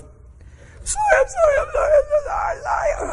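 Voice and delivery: very high, squeaky mock wail; hammed-up bawling, words dissolving into squeals; imitated uncontrollable crying. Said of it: "She's crying uncontrollably".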